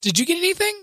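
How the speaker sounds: high, light mock voice asking; wife's question. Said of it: "and she said".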